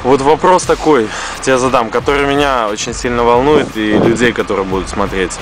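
ordinary talk, nothing marked

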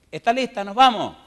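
llamando fuerte y agudo desde la puerta; llamada alegre. Retold or imitated. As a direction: grita animada para que salga. (llamando a la vecina en voz alta)

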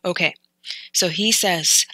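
normal talking voice

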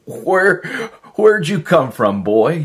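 high, loud, delighted greeting; joyful surprise. Acted. Lonely man greets the dog, high and loud with joy. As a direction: burst out gladly to the dog.

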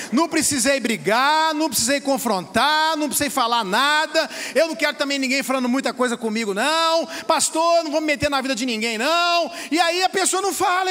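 fiery preaching. Real, not acted. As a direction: drive it home from the pulpit. Pastor preaching to congregation. (pregação inflamada)

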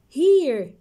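loud, high call; loud exclaim. Shown as a demonstration. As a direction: call it out bright. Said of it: "So let's read".

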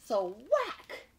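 emphatic storybook WHACK; dramatic read of big print; big sound effect. A narrator's reading. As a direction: punch the word "whack" as a sudden smack. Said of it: "Do you see how big they wrote that?".

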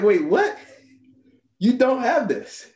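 shocked disbelief. Retold or imitated. Doctor hearing his own doubt, stunned and laughing. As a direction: react as if you can't believe what you heard.

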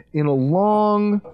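slow, stretched words; drawn out emphasis. Acted. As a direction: stretch the phrase out. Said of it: "this might be my favorite draft".

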